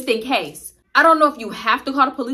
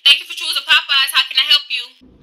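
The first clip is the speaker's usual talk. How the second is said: higher, louder customer-service greeting; usual drive-through greeting; 4 semitones higher, 6 dB louder than usual. Shown as a demonstration. Cashier greets a drive-through customer, chirpy service voice. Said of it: "So I do my usual".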